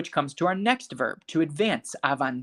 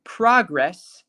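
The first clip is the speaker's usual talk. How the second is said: PROG-ress, punched on the first syllable; noun stress, first syllable; 3 semitones higher, 5 dB louder than usual. Shown as a demonstration. Teacher confirms the noun for class, "progress" stressed on PROG. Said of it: "Progress is the sustantivo".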